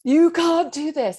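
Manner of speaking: high, loud and slow dread; fearful inner voice. Retold or imitated. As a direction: warn yourself slowly, full of dread. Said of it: "that voice goes".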